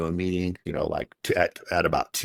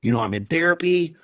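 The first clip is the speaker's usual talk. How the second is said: retold cry, much higher and louder; desperate outburst; 11 semitones higher, 3 dB louder than usual. Telling his wife he is in therapy and sober, high desperate shout. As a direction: plead in exasperation at the top of the voice. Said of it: "I'm like".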